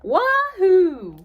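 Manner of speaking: high, loud joyful whoop; excited whoop. A narrator's reading. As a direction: whoop with glee. Duck thrilled driving.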